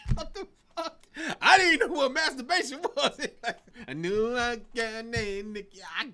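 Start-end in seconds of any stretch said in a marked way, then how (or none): 0.1-1.1 put-on shocked kid. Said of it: "I'm like".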